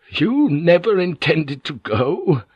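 he can hardly believe it, dawning; slow disbelief. Acted. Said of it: "clarity of the idea suddenly hit him".

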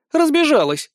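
high, teasing jeer; mocking amusement. A narrator's reading. Succubus mocking the cat girl's claim, amused jeer. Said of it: "смеётся суккубка".

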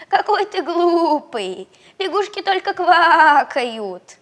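high, loud, slow laughing frog; mock him like a laughing frog.

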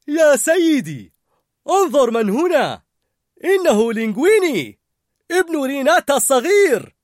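high, loud eager cry; excited. A narrator's reading. Larousse greeting the chef excitedly. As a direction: call out the chef's name with delight. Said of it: "قال لاروس بحماسة".

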